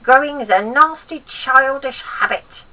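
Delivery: put-on high, loud cranky old woman; grandma voice. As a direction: nag the boy like a spiteful old grandma.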